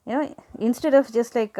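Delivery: usual speaking voice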